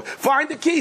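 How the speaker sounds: high-pitched urgent order; exasperated demand. Retold or imitated. Husband ordering wife to find the key, exasperated. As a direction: demand it, voice leaping up.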